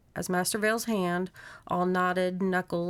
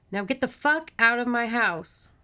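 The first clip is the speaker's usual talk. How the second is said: loud, high, emphatic command; angry order; 4 semitones higher, 5 dB louder than usual. A narrator's reading. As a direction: order an intruder out firmly. Margaret orders the monster out.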